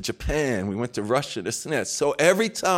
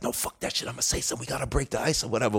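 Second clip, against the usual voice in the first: softer defiant whisper; 5 dB quieter, faster than usual. Retold or imitated. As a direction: whisper back, insisting. Whispering back at dinner.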